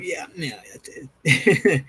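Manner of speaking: smug, self-satisfied bragging; cocky. Retold or imitated. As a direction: brag like a small-town big shot. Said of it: "when he gets cocky".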